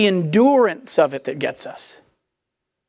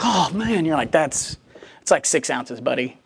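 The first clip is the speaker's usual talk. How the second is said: mock gym bro; 8 semitones lower, 5 dB quieter than usual.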